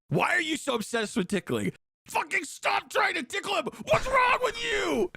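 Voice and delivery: hammed-up fury at the tickler; outraged shout. Mocks an angry Reddit comment.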